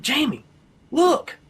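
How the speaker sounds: high, urgent call for attention; imitating an amazed friend calling out; retold excited call. Friend calls to him, amazed.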